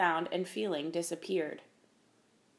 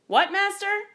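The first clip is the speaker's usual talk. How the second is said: high, loud questioning; puzzled query; 13 semitones higher, 7 dB louder than usual. A narrator's reading. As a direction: pitch leaping up in eager puzzlement. Apprentice has not heard master.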